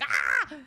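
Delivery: short, loud cry of panic; abrupt re-enacted scream; panicked yelp. Retelling her reaction, cries out.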